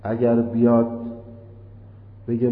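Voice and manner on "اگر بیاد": ordinary talk, nothing marked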